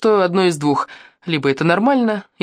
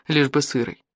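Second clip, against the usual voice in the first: ordinary delivery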